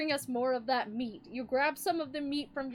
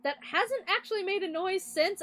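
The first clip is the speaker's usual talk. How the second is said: normal talking voice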